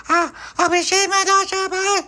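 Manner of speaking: put-on baby voice. Baby Lucy waving bye in a tiny high voice. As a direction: chirp it like a tiny child.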